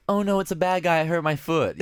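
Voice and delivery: high, whiny, childish bad-guy panic; high whiny Deadpool. Mocking Deadpool to a cohost, high and whiny. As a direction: whine it like a little kid who hurt his foot. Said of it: "he's really high and whiny".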